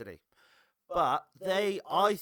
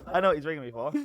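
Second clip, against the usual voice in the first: laughing; 7 semitones higher, 7 dB louder, faster than usual. Real, not acted. Laughs about the caller.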